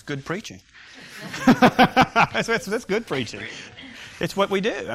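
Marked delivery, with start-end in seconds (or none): 1.5-3.4 laughing. Real, not acted. Laughs at the student's remark.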